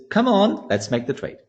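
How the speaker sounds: high, urging little push; lightly acted eager urging; chart technician. First inner voice pushing for the trade, eager. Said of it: "the first voice says".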